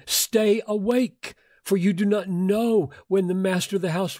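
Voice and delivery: earnest slowed-down charge to stay awake; urgent warning. Acted. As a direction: plead with them to keep watch. Urgent reading of Jesus' warning.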